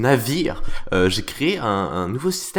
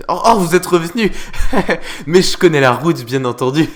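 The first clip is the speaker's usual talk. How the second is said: hammed-up welcome; 5 semitones higher, 8 dB louder than usual. Greets viewers back, exaggerated.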